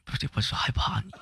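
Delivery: softly, nearly whispered and quick; real nervousness. Real, not acted. Streamer confessing fear to a visiting big brother, nervous and hushed.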